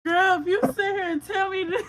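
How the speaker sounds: loud, high burst of disbelief; stunned disbelief. Real, not acted. Reader to client after a startling name match, stunned disbelief.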